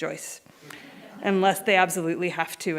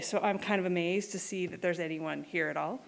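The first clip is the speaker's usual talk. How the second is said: ordinary talk, nothing marked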